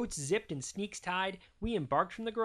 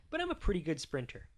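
usual speaking voice

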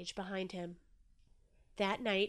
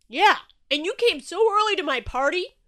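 loud, high-pitched reassurance; hearty, belted-out agreement; booming Shark; 9 semitones higher, 14 dB louder than usual. A narrator's reading. Shark reassuring Inky about his party, booming.

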